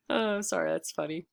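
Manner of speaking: ordinary delivery, nothing marked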